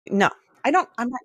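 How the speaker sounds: ordinary talk, nothing marked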